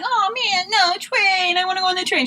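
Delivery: high, whiny sobbing plea; mock crying whine. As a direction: whimper it like a child begging. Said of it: "not just crying and like".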